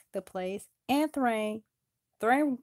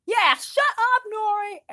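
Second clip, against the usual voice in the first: high mocking echo; piling on; 14 semitones higher, 5 dB louder than usual. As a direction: chime in high to mock him. Pile-on teasing Nori.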